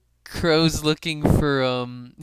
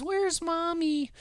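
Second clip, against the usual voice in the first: put-on high, slow small-child voice; little kid voice; 12 semitones higher, 5 dB quieter, slower than usual. The little kid at the door asking for mom, high child voice. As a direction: ask it like a lost little kid. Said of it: "and the little kid says".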